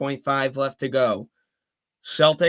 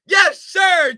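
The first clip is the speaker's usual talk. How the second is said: high, loud yell of joy; triumphant shout; 13 semitones higher, 9 dB louder than usual. Real, not acted. Caller cheering the tying shot, triumphant shout. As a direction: punch it out like a fist pump.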